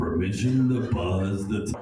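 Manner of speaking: deep, drawn-out mock pilot voice; Top Gun quote. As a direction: play the fighter pilot, low and slow. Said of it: "I quote lines from the Top Gun movie".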